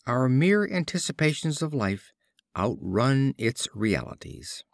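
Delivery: usual speaking voice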